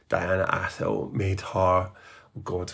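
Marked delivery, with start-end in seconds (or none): 1.9-2.7 breathy, near-whispered catch of emotion; choked up. Real, not acted. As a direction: let the feeling catch your throat. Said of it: "we're getting emotional".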